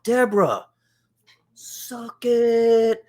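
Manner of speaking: high, cocky schoolkid taunt; kid taunt. Retold or imitated. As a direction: rub it in like a smug little kid.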